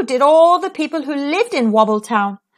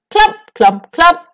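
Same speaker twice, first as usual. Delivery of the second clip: heavy footsteps; 7 semitones higher, 6 dB louder, slower than usual. Acted.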